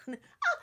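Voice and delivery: high mock scream; short shrill yelp of panic; imitating her own high-pitched scream; retold scream.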